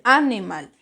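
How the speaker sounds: "animal" wrongly stressed as Á-nimal; stress on first syllable. Shown as a demonstration. Teacher shows how not to say it, "animal" stressed on A. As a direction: punch the opening A of "animal". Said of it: "la mayor fuerza de voz en mal, porque usted no dice".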